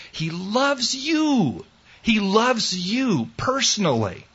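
high, very slow, drawn out on the word you; tender emphasis. Acted. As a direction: let it melt, reaching out to every listener. Preacher lingers on the core message.

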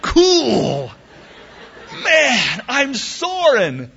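high, loud, gleeful; exhilarated. Acted. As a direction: ride the thrill of first flight. Eaglet flaps then soars in joy.

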